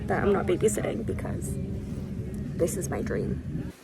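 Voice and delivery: hushed contented murmur; whispered. Real, not acted. Dreamy at the beach.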